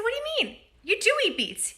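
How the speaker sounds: surprised. Retold or imitated. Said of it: "I said".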